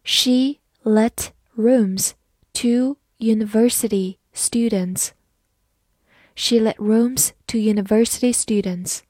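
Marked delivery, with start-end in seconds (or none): 0.0-5.1 slow, word-by-word demonstration; teacher's measured read-along. Shown as a demonstration. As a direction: read it slowly, pausing after each word. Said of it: "慢慢来读".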